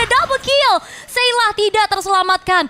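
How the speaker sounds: high, loud hype shout; excited play-by-play. Real, not acted. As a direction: yell the kill. Caster calls a double kill.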